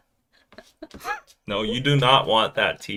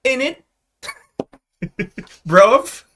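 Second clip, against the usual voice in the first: high British caricature; exaggerated Brit-slang accent; mock British slang; 11 semitones higher, 6 dB louder, slower than usual. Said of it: "Whatever you guys say".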